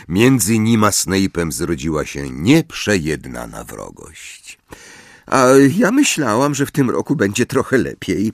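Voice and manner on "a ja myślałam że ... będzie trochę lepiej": disappointed. Acted. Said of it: "tonem pełnym zawodu".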